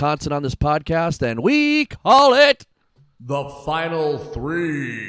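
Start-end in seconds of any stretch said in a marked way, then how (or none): 1.4-5.1 slow, drawn-out dramatic announcement; slow grand segment title; announcer voice. Shown as a demonstration. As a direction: build it like a big segment intro. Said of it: "announcing podcast segment".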